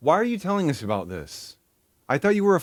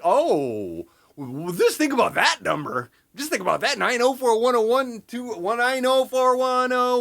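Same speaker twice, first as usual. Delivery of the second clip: mocking the video guide; 6 semitones higher than usual. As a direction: drag out the numbers like a tour guide. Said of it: "I'm like".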